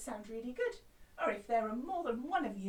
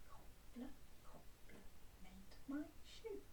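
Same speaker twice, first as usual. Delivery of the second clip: whisper; 13 dB quieter than usual. Shown as a demonstration. Teacher demos whisper echo.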